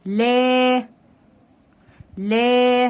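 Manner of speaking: "لا" with a long, held aa; long alif madd. Shown as a demonstration. Teacher repeating alif madd, stretched "لا". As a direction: sustain the vowel of "لا" each time. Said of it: "مد بالالف".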